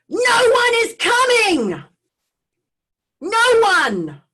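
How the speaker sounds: high distorted shout rushing past; booming voice flying past, Doppler-like; distant shout. Retold or imitated. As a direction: shout it like a voice from a passing car. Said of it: "hear someone shouting out of a car".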